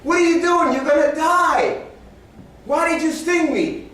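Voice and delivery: high, loud frog crying out in protest; high-pitched mock-desperate cry; drowning frog. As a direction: wail it as a betrayed, sinking frog. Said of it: "the frog said".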